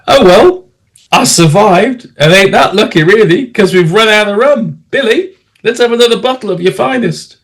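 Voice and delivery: high-pitched show of good cheer to drinking mates; jovial bravado. Acted. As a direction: brush it off merrily, pitched well up. Tipsy narrator calls for more rum.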